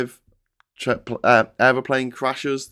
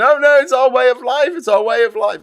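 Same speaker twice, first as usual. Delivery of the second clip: high, loud whining mock voice; hammed-up posh whinge; whiny toff caricature; mocking whiny protester; 13 semitones higher, 7 dB louder than usual.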